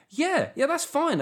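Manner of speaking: high-pitched sarcastic agreement; mock approval. Comedian reacting to broadcasters floating vigilantism, high sarcastic approval.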